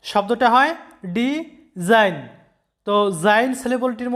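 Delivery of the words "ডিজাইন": ডিজাইন said very slowly, di - zine; slow syllable split. Shown as a demonstration. Teacher showing students the syllable break, slowly. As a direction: drag ডিজাইন out into two clear syllables. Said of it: "সিলেবলে ভাগ করে নিলে".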